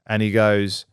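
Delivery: usual speaking voice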